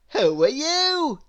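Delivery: a surprised Mister Happy asking. A narrator's reading. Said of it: "he asked".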